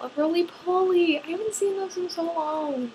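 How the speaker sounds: bubbly excitement; delighted. Real, not acted. Delighted remembering bug.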